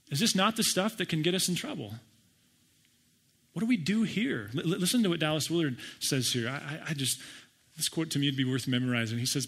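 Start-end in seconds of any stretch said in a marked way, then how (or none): none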